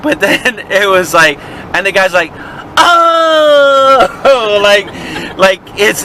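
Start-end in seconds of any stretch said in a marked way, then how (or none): 2.8-4.5 high, loud, drawn-out cry; mock loud realization. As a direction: let it burst out as exaggerated understanding. Clerk's sudden realization acted.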